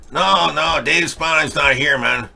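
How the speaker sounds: a hint of the Cheech Marin stoner Chicano accent; lazy Cheech Marin drawl on "Dave's not here, man". Host does the [name] bit for a chat request, in a light Cheech Marin accent. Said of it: "can you do the Chicha Marin accent".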